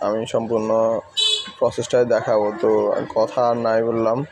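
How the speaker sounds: normal talking voice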